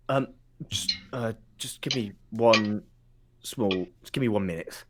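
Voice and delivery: flustered guard. Acted. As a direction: stall hesitantly for a moment. Confused guard stalls for time.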